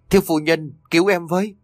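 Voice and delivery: high, pleading cry for help; cầu cứu thảm thiết. Acted. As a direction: beg desperately through the pain. Beaten maid begs mistress. (người hầu bị đánh van xin thiếu phu nhân cứu mạng)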